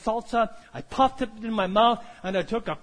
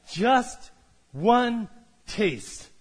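slow, deliberate preacher line; slow emphasis; much slower than usual. Acted. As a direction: stretch each word out to land the point.

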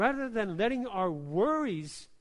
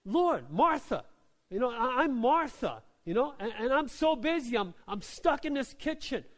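higher and louder, a flustered busy woman whining; exasperated complaining; 5 semitones higher, 4 dB louder than usual. Retold or imitated. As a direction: vent to Jesus about the lazy sister. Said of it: "She says".

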